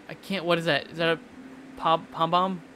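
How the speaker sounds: ordinary talk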